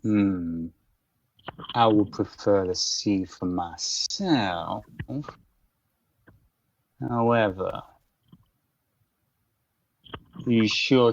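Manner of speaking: slow, measured Southern gentleman; lightly drawled, unhurried polite menace; courteous rival boss. Acted. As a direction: ease through it slowly, with formal courtesy. Game master voices Matthias.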